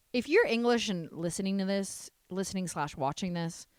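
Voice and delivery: ordinary delivery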